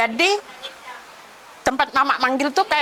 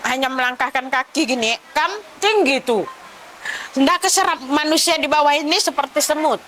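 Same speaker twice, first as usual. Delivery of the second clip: ordinary talk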